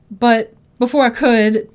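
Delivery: ordinary delivery, nothing marked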